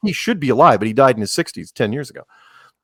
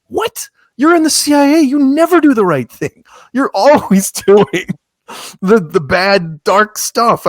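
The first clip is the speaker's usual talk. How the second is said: higher and louder, in disbelief at the premise; incredulous outburst; 8 semitones higher, 4 dB louder than usual. Real, not acted. Host scoffs to guest about Jack Ryan's CIA, incredulous and loud. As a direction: voice jumps up, astonished, pressing the point.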